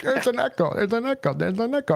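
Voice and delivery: mock high-pitched commenters chanting it; mocking chat comments. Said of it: "Everybody's like".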